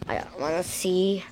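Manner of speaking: ordinary delivery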